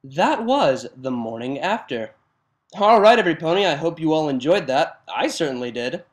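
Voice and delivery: loud, upbeat host signing off to listeners; lively host outro. Acted. Reader talking to listeners after the story, loud and upbeat. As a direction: greet the audience like a show host.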